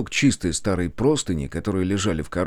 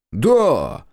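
sarcastic jump; 7 semitones higher, 6 dB louder than usual. Acted. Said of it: "чуть не подскочил он".

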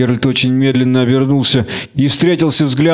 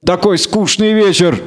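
higher-pitched, mock-friendly needling; goading gang leader; 8 semitones higher than usual. A narrator's reading. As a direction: egg the stranger on.

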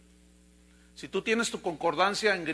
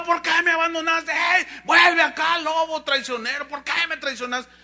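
high, loud hollering after a traitor; betrayed shouting; 8 semitones higher, 7 dB louder than usual. Acted. Deer abandoned in the well yells after the wolf, shouting. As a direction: yell it out in protest, pitched high. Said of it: "le gritaba".

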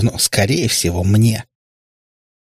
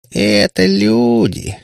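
high, drawn-out disdain; презрительно; 12 semitones higher, much slower than usual. A narrator's reading. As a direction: stretch it out with scornful contempt. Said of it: "презрительно скривила губы Кутияна". (с презрением, врастяжку)

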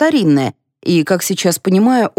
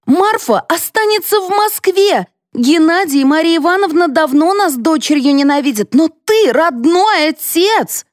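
high-pitched angry accusation; impassioned protest; 11 semitones higher than usual. A narrator's reading. Mother fighting the family over her daughter, impassioned. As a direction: accuse him in a high, heated voice.

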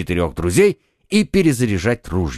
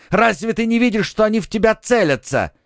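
alarmed shout; 10 semitones higher than usual. A narrator's reading. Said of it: "крикнул Д'Артаньян".

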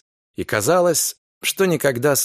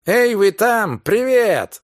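higher and louder hail out of the dark; booming greeting; 9 semitones higher, 4 dB louder, slower than usual. Acted. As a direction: call out loudly from afar. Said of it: "громогласное приветствие".

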